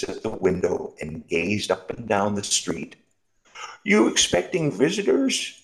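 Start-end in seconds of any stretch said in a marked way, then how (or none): 3.8-5.5 high curious question; curious road sweeper. Acted. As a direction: ask lightly, peering. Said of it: "asked the old road sweeper".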